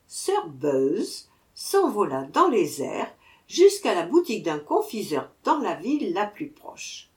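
ordinary delivery

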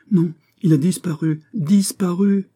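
high, loud, exasperated announcement; agitated report. Acted. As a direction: blurt it out in agitation, pitch climbing on the repeat. Chief reports suspect vanished. (annonce agitée, voix haut perchée)

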